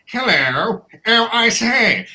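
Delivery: hammed-up foppish British officer, pitch rising on the question; mock nasal British accent. Mocking the film's redcoats in a nasal British accent. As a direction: lightly play a cartoon redcoat with a lisp. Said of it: "they all speak with lisps".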